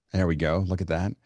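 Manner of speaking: ordinary talk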